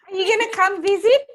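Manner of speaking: high, quick Indian-Australian aunt; funny aunt voice. Retold or imitated. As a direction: nag sweetly in a squeaky Indian-Australian accent. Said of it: "she's got a really funny voice too".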